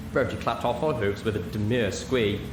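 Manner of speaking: usual speaking voice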